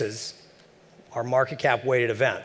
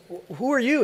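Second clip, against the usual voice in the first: startled stammer; 12 semitones higher than usual. Retold or imitated. Newcomer startled at door.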